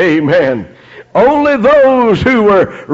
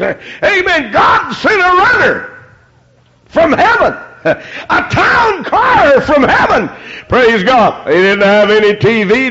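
preaching at full force; 7 semitones higher than usual. Acted. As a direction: shout it out like a revival preacher.

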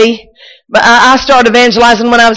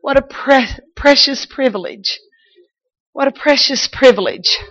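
soft, reverent murmur; hushed reverence; 3 semitones higher, 6 dB quieter than usual. Real, not acted. Preacher quietly marveling at a precious privilege. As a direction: say it softly, in awe.

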